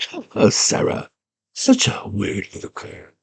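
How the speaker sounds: soft, whispery evil villain; sinister breathy purr; Jared. Retold or imitated. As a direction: imitate a creepy villain, hushed and taunting. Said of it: "He's a weird, evil one".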